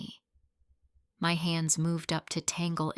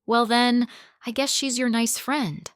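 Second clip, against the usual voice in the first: mocking, higher eye-rolling sarcasm; sarcastic scoff; 5 semitones higher, 4 dB louder than usual. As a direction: jab him with sarcasm. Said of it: "I rolled my eyes and scoffed sarcastically".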